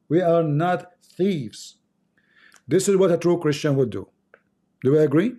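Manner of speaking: normal talking voice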